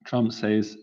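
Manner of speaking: usual speaking voice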